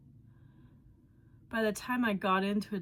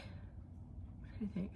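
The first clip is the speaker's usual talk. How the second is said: whispering; 12 dB quieter, much slower than usual. Real, not acted. Soft unvoiced slow aside.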